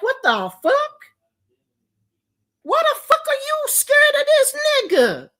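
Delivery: very high-pitched mock disbelief; incredulous outburst. Friend challenging a scared caller on the phone, incredulous.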